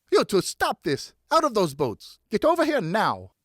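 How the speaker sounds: mother scolding her sons; angry scolding. Acted. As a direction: call them in sharply.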